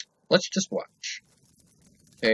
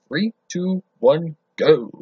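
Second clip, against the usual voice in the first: excited countdown; 5 semitones higher, 6 dB louder, slower than usual. Real, not acted. Vlogger counting his viewers into the video, getting louder to a shout. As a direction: build up to a loud shout on go.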